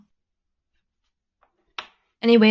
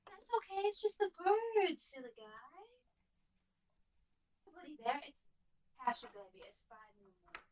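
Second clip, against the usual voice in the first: soft, high hushed soothing; calm the puppy down quietly; bedtime talk to pet; 7 semitones higher, 19 dB quieter, faster than usual. Real, not acted. Soothing barking dog at night.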